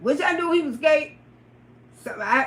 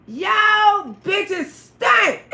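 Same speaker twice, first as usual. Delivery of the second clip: very slow shout; 5 semitones higher, much slower than usual. Retold or imitated. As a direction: spell out the insult. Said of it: "I say".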